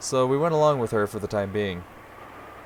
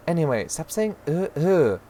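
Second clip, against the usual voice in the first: raised-pitch exasperated scolding; fed-up order to stop whining; irritated mother; 7 semitones higher, slower than usual. Acted. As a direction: tell the child off, mimicking the whine.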